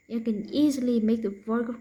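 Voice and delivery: ordinary talk, nothing marked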